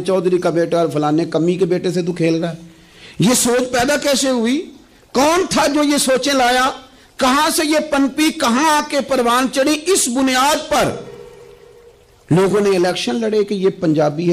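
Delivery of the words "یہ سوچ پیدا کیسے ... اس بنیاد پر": high, insistent rhetorical questioning; fervent preaching. Acted. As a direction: challenge the congregation in a raised pitch.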